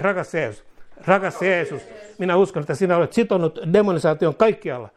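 high-pitched fervent prayer. Real, not acted. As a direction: lead the flock in earnest prayer. Leads congregation in prayer.

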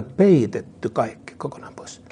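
slow, hushed whisper for emphasis; dramatic whisper. Real, not acted. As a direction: drop to a whisper on the promise. Preacher drops to a hush. (hidas, kuiskattu painotus)

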